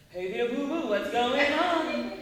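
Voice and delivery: loud cartoon voice, slowly swooping up at the end; big lively cartoon greeting, anything but monotone; Yogi Bear. Shown as a demonstration. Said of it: "It's not monotone".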